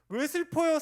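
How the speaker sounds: emphatic question. Real, not acted.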